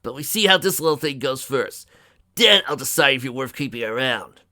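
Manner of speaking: loud, gruffly conceding; grudging frustration. A narrator's reading. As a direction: lay down a loud grudging condition. Dust's grudging outburst continues.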